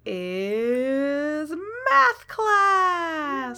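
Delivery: hugely drawn out, swooping up high; mock-grand announcement. Game master announces the next class to players, mock-grand and stretched. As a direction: unveil it like a game show prize.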